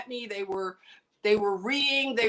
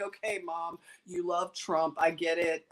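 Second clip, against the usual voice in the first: low, softer dismissive aside; humoring; 8 semitones lower, 4 dB quieter than usual. Retold or imitated. Daughter indulging her Trump-loving mom, humoring. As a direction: placate Mom in a low, toned-down voice.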